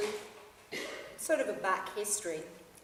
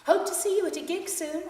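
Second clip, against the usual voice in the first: much higher bright parting shot; 11 semitones higher, 7 dB louder than usual. Acted. Singer leaving the fan behind, high bright parting shot. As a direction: toss it off as you flee. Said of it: "as a parting shot".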